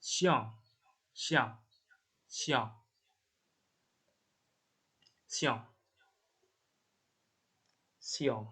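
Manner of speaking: xiong said as "siong", falling. Shown as a demonstration. Teacher modelling Chinese xiong for students, clear demo. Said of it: "Cara bacanya itu yang xian itu ya".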